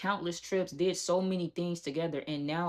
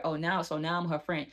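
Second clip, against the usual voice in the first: usual speaking voice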